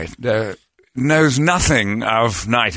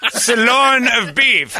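higher, slow grand proclamation; royal proclamation; 11 semitones higher, much slower than usual. Pretend. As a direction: drawl it like a herald. Imitating Brady's Bugs Bunny knighting.

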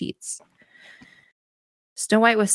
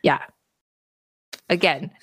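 ordinary talk

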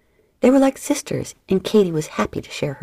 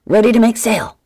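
loud, higher bellowed order; 6 semitones higher, 9 dB louder than usual. Acted. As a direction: bellow it across the ship. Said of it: "Then he bellowed".

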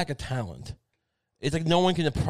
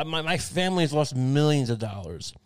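usual speaking voice

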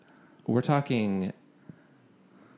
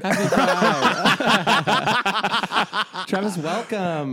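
laughing, high and loud; 8 semitones higher, 5 dB louder, much slower than usual. Real, not acted. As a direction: welcome him through laughter. Laughing welcome.

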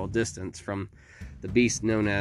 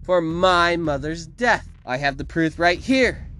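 high, loud, drawn-out outburst; exasperated villainess; 8 semitones higher, 3 dB louder than usual. A narrator's reading. Victoria scolds Felix and accuses the mayor, angry. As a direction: scold the beast, then accuse the town.

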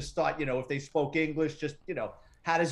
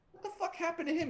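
shocked; 13 semitones higher, 5 dB quieter, faster than usual. Retold or imitated. Finding the passed-out visitor.

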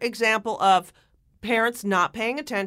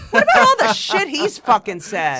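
loud, higher-pitched indignation; outraged retort; 5 semitones higher, 10 dB louder than usual. Real, not acted. Co-host firing back at Trump's demand for apology, outraged. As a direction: burst out in exasperated outrage.

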